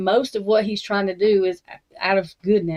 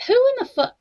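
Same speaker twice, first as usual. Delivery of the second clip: "None of it" cried out high and louder, furious disbelief; exasperated outrage; 5 dB louder than usual. Real, not acted. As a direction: pitch climbing higher and louder in disgust. Disgusted rant about rich people.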